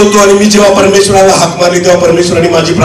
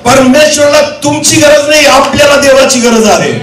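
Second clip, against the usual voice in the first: raised, insistent sermon cadence; impassioned preaching; 9 semitones higher than usual. Acted. Preacher telling congregation they need God, impassioned preaching. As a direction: drive the point home to the congregation.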